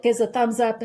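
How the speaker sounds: ordinary talk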